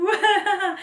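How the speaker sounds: high, loud imitated wail breaking into laughter; hammed-up crying child; mock toddler bawling. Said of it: "he starts to cry".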